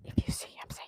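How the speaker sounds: hushed whispered instruction. Real, not acted. Host whispering the Waldo game rules to viewers. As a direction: whisper it like a secret game rule.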